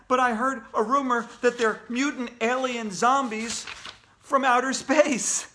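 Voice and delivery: high, breathless-gossip delivery; excitable girl. Acted. Girl telling Andrea a rumor, excitable.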